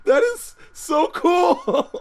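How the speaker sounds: high, slow, laughing exclamation; real laughing delight. Real, not acted. Host marvels at the Kenny coincidence, laughing delight. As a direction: stretch it out high through laughter.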